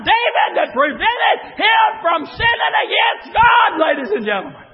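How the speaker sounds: shouted, high preaching cadence; preaching at full force. Acted. Preacher shouting to the congregation, full force. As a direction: preach it out to the church.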